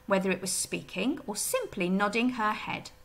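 usual speaking voice

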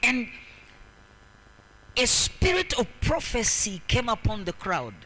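forceful sermon delivery in weighty, separated phrases; emphatic preaching. Real, not acted. Preacher telling the crowd of the healed man, emphatic preaching. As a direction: preach the miracle home, leaning on each phrase.

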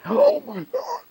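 high, drawn-out mock horror under the breath; shocked gasp. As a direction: gasp and squeak it slowly, acting out dismay. Young interpreter shocked by the secret.

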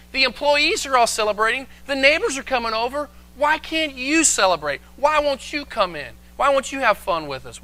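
high, imploring father's plea; pleading. Acted. Father begs angry son to join the party, pleading. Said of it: "he has to plead with him".

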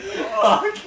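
loud, high laughing yell; shouted curse, half laughing; mock yelp of pain. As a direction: imitate a sudden outburst. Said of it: "You went".

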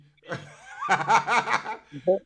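bursting out laughing; a big high laugh; loud laugh. Real, not acted. Laughing at own reading.

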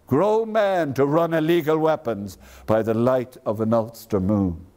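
ordinary talk

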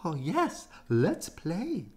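high excited little bunny; sister bunny. A narrator's reading. Thumper's sister joining the game, high excited cry. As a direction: squeal with delight. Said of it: "Thumper's sister cried".

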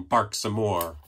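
plain reading voice

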